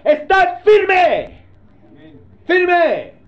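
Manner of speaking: high, loud shouted order, drawn out; divine command. Acted. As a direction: shout it as God's command, stretching each word. Voz de Dios ordena. (orden divina gritada, alta y alargada)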